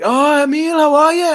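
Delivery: very high, loud mock-excited greeting; gushing greeting. Woman greeting him before the show, excitedly imitated. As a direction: imitate an over-excited woman saying hello.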